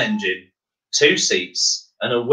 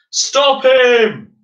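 loud, high angry cry; crowd shouting; 12 semitones higher, 6 dB louder than usual. Acted. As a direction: yell it out to stop him. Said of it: "they all shouted".

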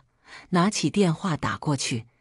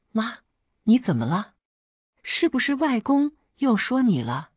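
a slightly higher, lightly voiced daughter; a hint of a concerned child's voice; young daughter; 6 semitones higher than usual. A narrator's reading. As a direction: lightly voice a daughter asking after her mother. Narrator voices the worried young daughter.

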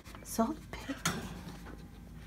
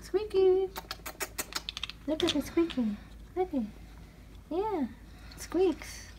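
much higher, sing-song pet voice; pet-talk coo; 9 semitones higher, 4 dB quieter, slower than usual. Real, not acted. Owner coaxes the small pet to look, in a high coo. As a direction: coo his name up high to coax him.

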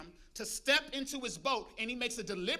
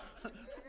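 breathy laugh; 12 dB quieter than usual. Real, not acted. Laughing at his story.

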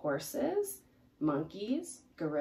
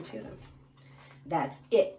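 ordinary delivery

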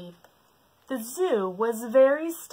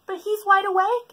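lightly voiced higher explanation; explaining giraffe; 13 semitones higher, 8 dB louder than usual. Giraffe explains to the lion, light. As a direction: reason with the lion. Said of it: "explained the giraffe".

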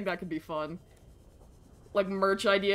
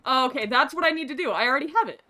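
ordinary talk

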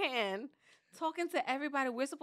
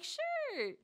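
much higher, breezy cool-parent voice; 14 semitones higher than usual. Retold or imitated. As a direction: play it cool and carefree, pitched way up. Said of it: "And I'm like".

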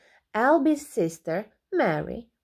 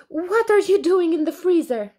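alarmed; 8 semitones higher, 6 dB louder than usual. A narrator's reading. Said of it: "wailed Alby".

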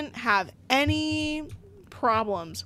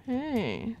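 soft call; 6 dB quieter than usual. Acted. Trying to get someone's attention, softly. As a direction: try for attention quietly.

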